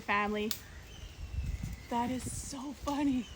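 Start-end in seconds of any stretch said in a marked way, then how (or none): 1.9-3.4 soft, high giggle; stifled laughter; laughing. Real, not acted. Trying not to show teeth to the monkeys, giggling. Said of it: "still can't stop laughing".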